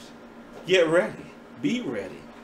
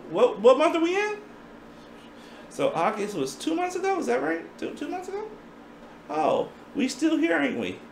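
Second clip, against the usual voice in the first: mock surprise; 12 semitones higher than usual.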